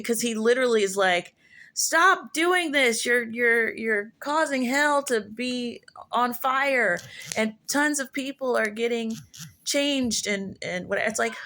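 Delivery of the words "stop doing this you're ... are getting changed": high, slow, drawn-out theatrical complaint; mock a devil pleading melodramatically, pitched up; cringy devil. Devil telling a believer to stop, cringily theatrical. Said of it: "It's super cringy".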